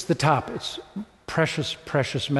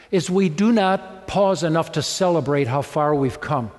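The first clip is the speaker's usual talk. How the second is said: ordinary talk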